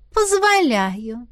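slow, purring permission. A narrator's reading. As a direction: purr it out lazily. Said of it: "промурлыкала Аварилиан".

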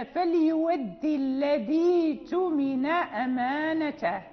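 slow recitation, الذي اؤتمن run together without a break; joined-up recitation. Shown as a demonstration. Teacher reciting a verse to show continuous reading, slow linked recitation. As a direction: recite slowly, linking الذي straight into اؤتمن. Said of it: "أما في الوصل فلا يكون هناك سبب".

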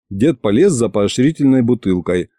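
plain reading voice

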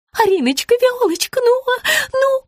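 very high, hesitant pet names; flustered cooing. A narrator's reading. Rita fumbles to soothe her pinched client, flustered. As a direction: stammer sweetly in a high voice.

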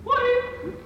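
sudden high startled "Ой!"; startled cry. Acted. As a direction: gasp out "Ой!" as if about to fall. Larisa looks down from cliff. (испуганный вскрик)

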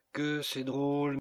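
plain reading voice

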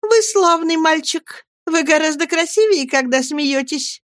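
high, sing-song coaxing little voice; playful young wife. A narrator's reading. Dora flatters her serious husband, playful high voice. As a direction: coo it high, like a pampered darling.

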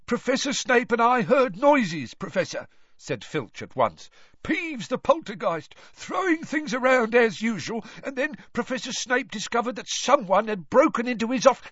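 high, rushed babble; Filch hurried. A narrator's reading. Filch explaining to Moody, high and rushed. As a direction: blurt it all out fast.

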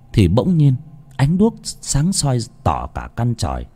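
ordinary delivery

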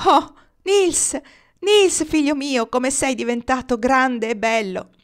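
high, loud overjoyed exclamation, slow on his name; a mother rejoicing at her son's return; joyful cry. Acted. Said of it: "il grido più forte e giubilante fu quello della madre". (grido di gioia alto e forte, la madre ritrova il figlio)

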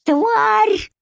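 very high, strained cry; exhaled insult. A narrator's reading. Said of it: "неслышно выдохнула Эмма".

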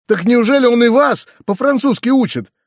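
high exclaimed question, a touch of fright; mildly alarmed cry. A narrator's reading. Nephew hears peasants are taught French, mild alarm. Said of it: "вскричал я почти в испуге".

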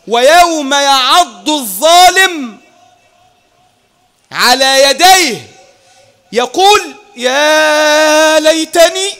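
very slow, loud, rising recitation of lament; impassioned recitation. Acted. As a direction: chant the verse in rising anguish. Climactic recitation of regret verse.

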